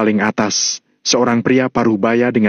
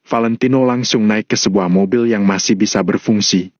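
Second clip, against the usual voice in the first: ordinary delivery, nothing marked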